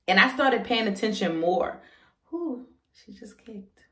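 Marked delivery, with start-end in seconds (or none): 2.3-3.8 soft slow whisper; whispered surprise. Real, not acted. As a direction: gasp it under your breath. Feels a kick mid-talk.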